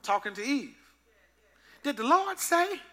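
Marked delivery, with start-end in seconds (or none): 1.8-2.8 lightly voiced sly serpent, high; a hint of a tempting serpent. Serpent tempting Eve with a lightly voiced sly question. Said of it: "here come the serpent talking to Eve".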